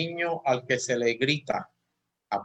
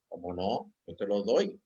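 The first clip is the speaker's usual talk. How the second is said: lower, softer reassuring reply; soft lower voice of a parent to a toddler; soft to child; 4 semitones lower than usual. Retold or imitated. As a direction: gently hand the toy over, speaking low. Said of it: "él me decía que sí".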